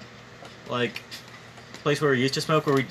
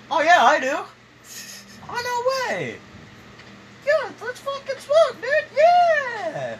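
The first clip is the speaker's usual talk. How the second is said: high, hyped-up stoner voice; excited; 4 dB louder, slower than usual. Retold or imitated. Acting out their first chat.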